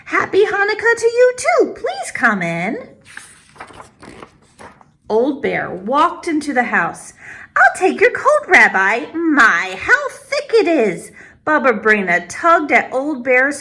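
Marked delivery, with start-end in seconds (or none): 0.0-2.9 put-on high, bright old grandmother voice; old woman greeting a visitor at her door; Baba Brina greeting. 7.6-11.1 put-on high, bright old grandmother voice; old woman greeting a visitor at her door; Baba Brina greeting. Baba Brina takes coat.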